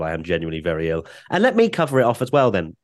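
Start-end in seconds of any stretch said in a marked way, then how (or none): none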